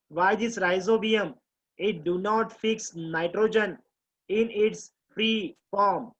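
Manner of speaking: very slow, deliberate question; slow dictation. Shown as a demonstration. Teacher to students, dictating the question slowly. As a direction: read it out slowly for notes. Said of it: "teacher dictating a question".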